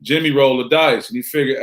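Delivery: normal talking voice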